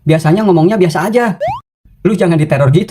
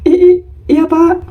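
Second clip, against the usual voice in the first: high, stammering new pupil; nervous stutter; 11 semitones higher, slower than usual. Acted. New pupil stammers to the teacher, nervously stuttering. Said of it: "Jawabnya sopan".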